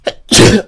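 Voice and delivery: loud sudden sneeze; real sneeze. Real, not acted. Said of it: "I keep sneezing".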